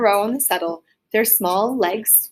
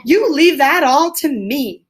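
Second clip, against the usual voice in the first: masterful; 6 semitones higher, 6 dB louder, slower than usual. Acted. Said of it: "said the masterful rat".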